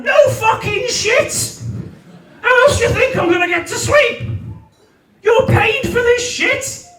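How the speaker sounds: high-pitched comic rant; exasperated outburst. Acted. Sleepless man answering the meditation app, exasperated. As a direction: rail indignantly, far above normal pitch.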